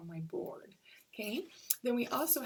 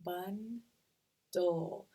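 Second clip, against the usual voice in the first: bundle in two drawn-out beats; "bundle" said as bun, pause, dle; split into syllables. Shown as a demonstration. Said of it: "I hear that final syllable".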